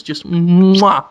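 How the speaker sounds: loud smacking kiss; kiss sound. Shown as a demonstration. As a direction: plant a big loud mwah. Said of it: "like that".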